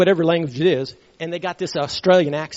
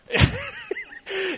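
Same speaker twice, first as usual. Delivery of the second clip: high, wheezy burst of laughter; amused laugh. Real, not acted. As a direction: crack up at your own punchline. Laughs at his own punchline.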